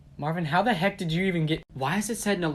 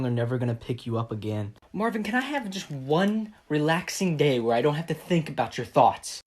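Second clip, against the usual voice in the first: usual speaking voice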